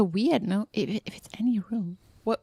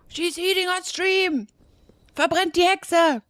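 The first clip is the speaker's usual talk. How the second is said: angry mob leader; 7 semitones higher than usual. Retold or imitated. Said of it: "Now everyone just rage quits".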